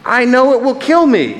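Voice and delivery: very high, distressed wail of words; anguished cry. Acted. Ghost dreading death at the angel's hand, high anguished cry. Ghost fears being killed.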